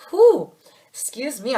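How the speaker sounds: breathless after coughing; recovering from a cough. Real, not acted. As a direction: catch your breath, excuse yourself. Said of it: "I just got choked out".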